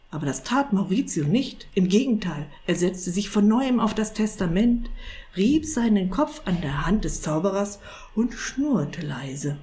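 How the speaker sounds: ordinary delivery, nothing marked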